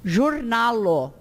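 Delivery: JORNALO with zh as in Portuguese J; slow JORNALO opening on a buzzing zh; true J sound. Shown as a demonstration. Teacher demonstrates accented J, zh in JORNALO. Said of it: "tem o som do J mesmo".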